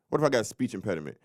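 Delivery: ordinary delivery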